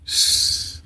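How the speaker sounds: soft, voiceless "shh"; hushed hiss. Retold or imitated. Inmate reacts to being called out, quiet hiss. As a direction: let air out quietly through the teeth.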